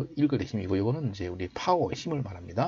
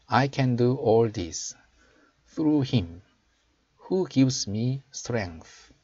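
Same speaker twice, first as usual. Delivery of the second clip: slow, raised teaching voice; slow model reading; 3 semitones higher, 4 dB louder, much slower than usual. Shown as a demonstration. Teacher showing learners the English verse, slow model reading. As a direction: read it out slowly for learners to copy. Recites whole verse again.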